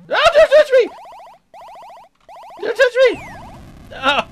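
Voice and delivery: high loud shriek, the last one dragged slowly. Pretend. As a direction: scream it high as the poop gets grabbed. Character shrieks in game.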